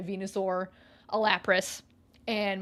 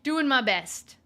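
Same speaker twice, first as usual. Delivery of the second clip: very slow, louder declaration; slow, louder mock proclamation; slow emphatic; 3 semitones higher, 6 dB louder, much slower than usual. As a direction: plant each word like a proud motto. Repeating meme with emphasis.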